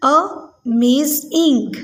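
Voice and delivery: "amazing" slowly broken into a, maz, ing; syllable by syllable. Shown as a demonstration. Teacher shows pupils the syllables, "amazing" split in three. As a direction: pause between the three beats of "amazing". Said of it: "ये amazing के syllables हैं".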